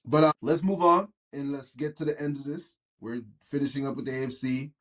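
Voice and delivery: usual speaking voice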